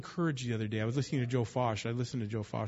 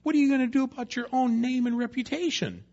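much higher and louder, pressing God; pointed challenge; 11 semitones higher, 7 dB louder than usual. Retold or imitated. Joshua confronting God about His name, loud and high. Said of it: "And then he says".

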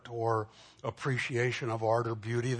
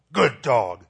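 much louder, sudden mock telling-off; stern scolding; 9 dB louder than usual. Shown as a demonstration. Pastor scolding the dog to make him cower, stern. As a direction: scold a dog using words of praise. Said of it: "On the other hand, I can go".

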